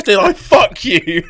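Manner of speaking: mocking the granddad's question while cracking up; laughing mock question.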